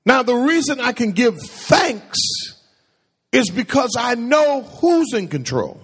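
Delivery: ordinary talk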